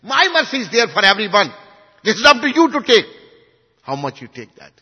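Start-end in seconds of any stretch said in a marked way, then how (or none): 0.0-3.1 loud, higher, emphatic quote; quoting a teacher with raised, insistent voice. Retold or imitated. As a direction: proclaim it forcefully to everyone. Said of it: "very emphatically said".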